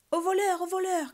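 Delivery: high, loud cry of thief; alarmed bird cry. A narrator's reading. Stork guarding its nest from a climber, alarmed cry. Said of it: "claqueta un énorme oiseau".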